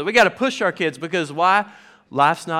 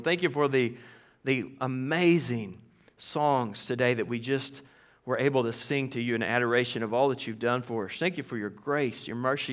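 pulpit prayer; 4 semitones lower, 4 dB quieter than usual. Acted. Pastor praying for the congregation, softer and earnest. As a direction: pray it earnestly over the church.

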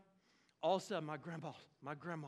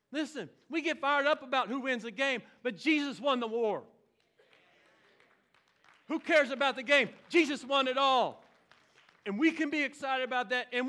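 loud, driving preacher's call; fiery preaching; 6 semitones higher, 9 dB louder than usual. Acted. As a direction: preach it so the back row hears. Preaching at full force.

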